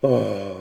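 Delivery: long, voiced sigh of regret; drawn-out pained "oh"; deep sigh. A narrator's reading. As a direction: heave a heavy, audible sigh. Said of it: "poussa un profond soupir".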